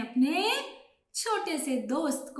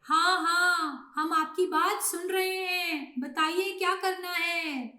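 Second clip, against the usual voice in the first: higher-pitched, eager chorus-like reply; flock of pigeons answering; 5 semitones higher than usual. Acted. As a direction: obedient storybook birds. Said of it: "सभी कबूतर ... बोले".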